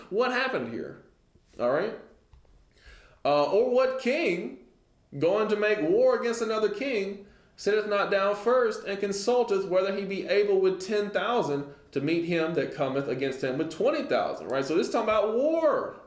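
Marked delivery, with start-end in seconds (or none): 0.0-1.0 higher, laughing mock bewilderment. Passerby mocking an unfinished building, laughing and higher. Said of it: "Everybody looks at it and is like". 3.4-14.5 raised, measured scripture reading. Real, not acted. Preacher reading the verse to his congregation, raised and measured.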